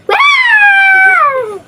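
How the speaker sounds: high, loud shouted wow; imitating vlogger. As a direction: belt a hammed-up vlogger wow. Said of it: "ganito siya".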